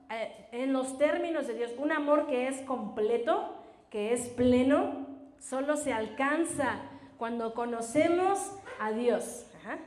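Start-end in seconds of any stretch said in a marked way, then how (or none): none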